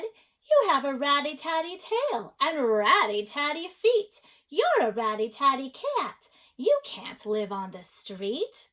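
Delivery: high, loud scolding, easing toward the end; people scolding a cat. A narrator's reading. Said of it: "The people said".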